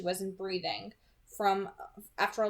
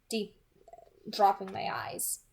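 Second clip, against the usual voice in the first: ordinary delivery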